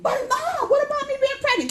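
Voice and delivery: high, loud, fast plea; mock-pleading teen; whiny protesting daughter. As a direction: whine it like a teenager.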